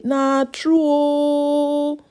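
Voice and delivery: very slow, drawn-out "Na true o"; slow agreement. Retold or imitated. Agreeing with the man's point, very slow. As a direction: stretch it out in awe. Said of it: "am come talk say".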